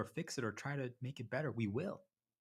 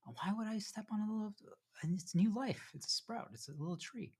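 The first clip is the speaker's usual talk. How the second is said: tender; 10 semitones higher than usual. Real, not acted. Tender about a little sprout.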